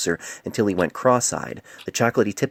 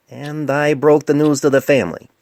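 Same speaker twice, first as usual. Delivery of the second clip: put-on young doctor voice; 8 semitones higher, 9 dB louder than usual. A narrator's reading. Painless voiced, raised.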